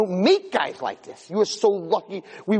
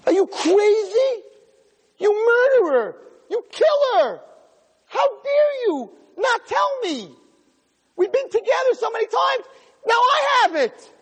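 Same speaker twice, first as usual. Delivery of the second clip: furious scream. Acted. Wife screaming at her husband who hid HIV, furious shriek.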